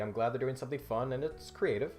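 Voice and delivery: ordinary talk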